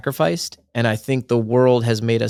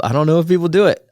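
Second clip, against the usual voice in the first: louder, faster, higher restatement; emphatic restatement; 6 semitones higher, 5 dB louder, faster than usual. Real, not acted. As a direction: push it out faster and louder the second time.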